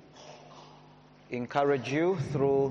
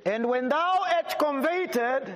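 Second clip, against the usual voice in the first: raised, slowed King James reading; scripture reading; 5 semitones higher than usual. Acted. Preacher reading Luke aloud to the congregation, raised and proclaiming. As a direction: proclaim the verse to the hall.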